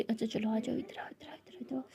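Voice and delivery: soft coaxing whisper; hushed call to come close. Retold or imitated. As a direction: whisper her over to your shoulder. Soothing partner to sleep.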